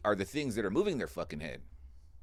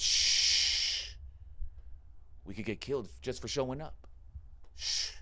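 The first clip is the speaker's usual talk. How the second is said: urgent hush. Retold or imitated. Friend hushes him, scared.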